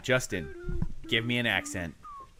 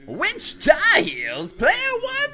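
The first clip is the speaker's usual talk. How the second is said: put-on high, loud announcer with old radio ring; old-timey broadcaster; 4 dB louder than usual. Friend requests old-timey broadcaster, host reads question as radio announcer. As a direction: boom it out like a 1930s radio man. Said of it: "old-timey broadcaster".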